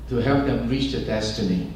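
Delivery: ordinary talk, nothing marked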